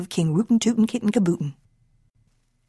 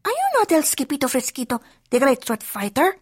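higher, quick, wondering question; little dog asking; 5 semitones higher, faster than usual. A narrator's reading. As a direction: ask quickly in a higher character voice. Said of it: "asked Poquito Tito".

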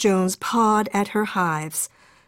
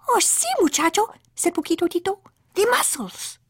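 tiny dog voice; 10 semitones higher than usual. Acted.

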